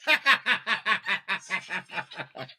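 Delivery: long, high run of laughter; high staccato laugh that keeps going; giggling fit. Real, not acted. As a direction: burst into helpless rapid giggles. Host cracks up during introductions.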